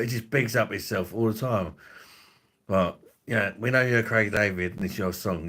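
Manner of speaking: normal talking voice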